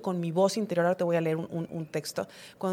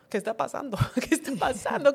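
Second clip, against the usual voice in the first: pregunta repetida entre risas, cada vez más aguda; riendo; 4 semitones higher than usual. Real, not acted. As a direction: se le escapa la risa mientras pregunta. Se ríe repitiendo la pregunta. (riéndose de sí misma al preguntarle a la culpa)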